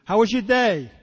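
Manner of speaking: high, loud bright question; sponsor's cheery check-in. Retold or imitated. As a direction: sound upbeat asking about the day. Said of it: "My sponsor would call me and says".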